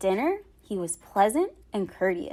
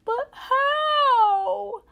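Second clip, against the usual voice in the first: high, loud wailing plea; distressed cry; 14 semitones higher, 5 dB louder than usual. Acted. Boy forced to choose his pants, wailing loudly. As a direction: wail it like the world is ending. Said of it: "Jameson cried".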